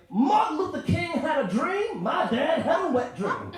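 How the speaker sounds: loud, high, slow shouted punchline; Chris Rock impression. Quoting Chris Rock to the crowd, loud high shout. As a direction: bellow it like a preaching comic. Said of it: "Or as Chris Rock would say".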